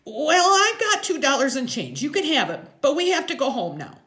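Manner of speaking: put-on high, loud little kid voice; anxious high-pitched kid offer; Freak, pleading. A narrator's reading.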